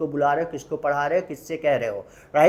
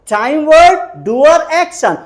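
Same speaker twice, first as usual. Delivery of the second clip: each of time, word, doer, action punched high and loud; emphatic formula drill; 10 semitones higher, 9 dB louder than usual. Shown as a demonstration. Teacher telling students not to forget the formula, emphatic.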